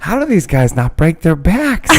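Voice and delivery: high, laughing mock voice of the friend; mock imitation of friend. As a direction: squeak it out teasing, laughing through it. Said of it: "That's what you said".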